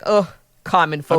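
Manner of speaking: low, disdainful snob; hammed-up aristocratic contempt; mock haughty noble. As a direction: sneer at peasants with mock disgust. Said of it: "you just step over me like".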